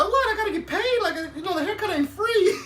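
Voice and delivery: high, innocent-sounding lie, well above his voice; high fake excuse. Barber caught in her purse, a high innocent excuse. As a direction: play innocent up high, caught red-handed.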